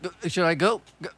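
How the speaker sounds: hesitate, then decide; a light mock deer; dithering deer. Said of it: "that dead deer's like".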